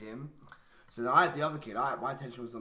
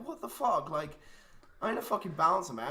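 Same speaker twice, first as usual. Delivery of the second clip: exasperated outburst; 5 semitones higher, 5 dB louder than usual. Retold or imitated. Fed up breaking up fight.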